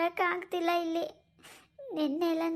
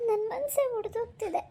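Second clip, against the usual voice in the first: high, crying anguish; grief-stricken and tearful; tearful breakdown; 4 semitones higher than usual. Acted. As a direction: sob out the heartbreak.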